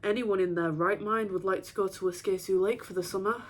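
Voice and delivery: ordinary delivery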